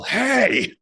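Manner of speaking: high-pitched mock holler; retold yell. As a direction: call out a warning yell without full volume. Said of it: "very clearly hear me yell".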